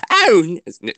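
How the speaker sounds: high, loud start of an impression; Seventh Doctor. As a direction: break into a lightly acted exclamation. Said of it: "you do the Seventh Doctor voice".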